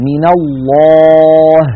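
الله with a light final ه. Shown as a demonstration. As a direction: slowly close الله on a light ه. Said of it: "هل رأيتم". (هاء مرققة)

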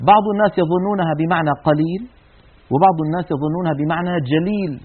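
ordinary talk

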